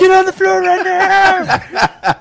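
high, slow, laughing mock yell; hammed-up police shout; cop yelling. Acting out a police stop.

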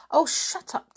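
high, sharp cross snap. Acted. George woken from her nap, crossly snapping at Timmy. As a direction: snap at the dog, annoyed. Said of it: "said George crossly".

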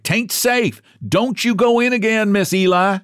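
high, urgent warning; crying out. A narrator's reading. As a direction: call out to stop someone. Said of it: "they cried".

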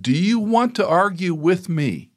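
a father daring his son, high and slow; stern father challenge. Retold or imitated. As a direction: dare him to keep arguing.

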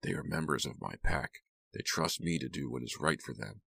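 hushed, confiding voice; quiet, controlled near-whisper. A narrator's reading. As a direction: speak softly into her ear. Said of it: "Kit whispered in her ear".

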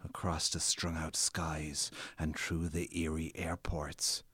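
reading the eerie journey in a slow whisper; hushed reading. Acted. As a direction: breathe the line out like a secret. Hushed line in dramatic reading.

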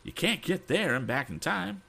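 high, incredulous protest; gasped disbelief. Acted. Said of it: "gasped Mister Damon".

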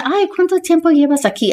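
high, curious mock voice of people asking; voz aguda y curiosa imitando a la gente. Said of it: "La gente dice".

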